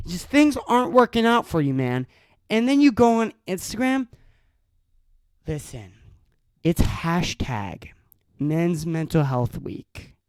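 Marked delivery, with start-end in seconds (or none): 5.5-10.1 mock whisper. Real, not acted. Mocking the hashtag.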